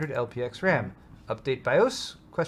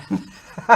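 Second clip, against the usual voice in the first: real laugh; 5 semitones higher, 10 dB louder than usual. Real, not acted. Laughing at erection pun.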